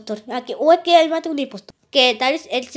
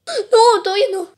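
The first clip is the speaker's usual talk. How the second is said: pleading; 8 semitones higher, 7 dB louder than usual. Pretend. Pleading not to be ejected.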